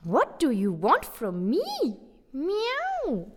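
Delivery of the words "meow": high, soft meow; high soft cat meow. Acted. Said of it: "hissed Sheila".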